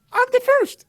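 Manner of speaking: very high astonished echo; amazed. Retold or imitated. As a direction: squeak it out in disbelief. Amazed echo of being first.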